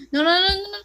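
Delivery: very loud, high rapid protest; panicked no's. Real, not acted. Player panicking at the screen, loud no's. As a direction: scream no over and over.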